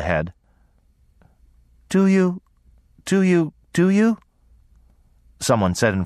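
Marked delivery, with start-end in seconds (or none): 1.9-4.2 put-on high, halting simple girl's voice; high thick-tongued little voice; thick, weak girl's voice. A narrator's reading. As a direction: repeat it thickly and feebly, pitched way up. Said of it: "a thick, weak voice".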